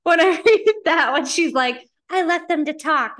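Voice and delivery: loud, high, laughing outburst; can't stop laughing while ranting; excited laughing rant. Real, not acted. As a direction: blurt it out, cracking up. Excited rant about the story.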